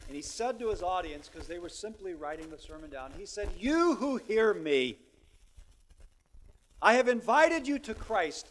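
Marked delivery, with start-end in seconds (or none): 3.6-8.5 preaching invitation. Retold or imitated. Quoting Spurgeon's invitation.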